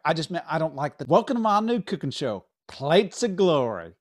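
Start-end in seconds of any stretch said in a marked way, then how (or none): 1.1-3.9 host intro. Acted.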